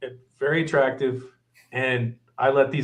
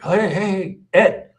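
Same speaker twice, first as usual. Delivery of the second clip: raised, higher-pitched urgent hail; imitated calling out; 6 semitones higher, 4 dB louder, slower than usual. Waiter fetching manager from the back, calling out. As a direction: call the name out louder, as if summoning.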